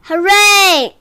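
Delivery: high, loud cheer; cheering cry. A narrator's reading. Everyone cheers the tortoise rescue, high excited cry. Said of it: "everyone cried".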